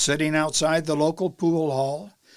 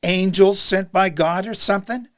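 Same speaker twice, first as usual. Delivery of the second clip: louder, raised sarcastic challenge; sarcastic yell; 3 semitones higher, 7 dB louder than usual. A narrator's reading. Beaten boy yells to the priest, sarcastic. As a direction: yell it with mocking disbelief. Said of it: "His tone is very sarcastic".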